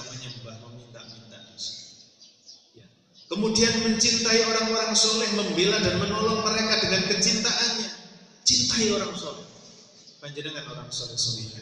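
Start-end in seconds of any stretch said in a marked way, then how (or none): 3.3-9.5 high, loud, projected proclamation; emphatic preaching. Acted. Preacher urging the congregation to love the righteous, emphatic preaching. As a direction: proclaim it forcefully in a raised voice.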